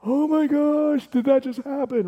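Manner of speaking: high, loud, hammed-up shock; mock freaked-out. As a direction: stretch it out in a high, stunned voice. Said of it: "they're just freaked out".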